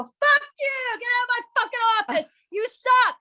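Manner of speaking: high, loud furious yelling, rushed; staged screaming fit. Acted. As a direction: blast the millionaire with fake rage. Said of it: "I turned it on for the show".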